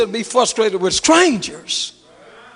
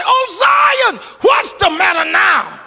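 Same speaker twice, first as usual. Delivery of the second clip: high wailing shout of a lament; high preacher's wail; anguished cry; 8 semitones higher, 3 dB louder, slower than usual. Acted. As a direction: cry it out to the heavens. Scripture quote cried out.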